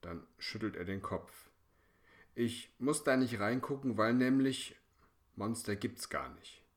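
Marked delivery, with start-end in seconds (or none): 2.3-6.6 higher, louder boast of courage; older brother insisting monsters are not real; defiant insisting. A narrator's reading. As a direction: talk yourself brave.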